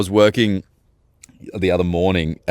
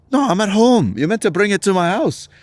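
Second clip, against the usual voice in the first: pitched way up, a mock demanding boss; squeaky put-on boss voice asking where's my coffee; 10 semitones higher than usual. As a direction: ape the boss barking for his coffee down the phone. Boss voiced in phone story.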